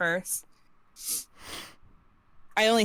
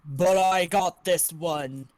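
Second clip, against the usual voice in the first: booming voice; 2 semitones lower, 3 dB louder, slower than usual. Acted. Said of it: "a voice nearly booms".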